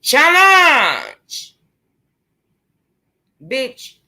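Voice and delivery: high, loud, long-held cry; James. Shown as a demonstration. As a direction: belt it out, stretched and falling at the end. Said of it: "And my James voice".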